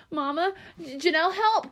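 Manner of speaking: high, desperate plea for help; begging in panic. Acted. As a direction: cry out for help in terror. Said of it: "Keisha begged".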